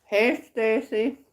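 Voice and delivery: talking with a mouth full. Real, not acted. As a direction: greet through a mouthful of food. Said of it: "Got my mouth full".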